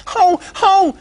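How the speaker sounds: very high, loud pig squeal; little pig squealing to fool the squirrel. Acted. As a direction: squeal out in mock panic. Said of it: "he squealed".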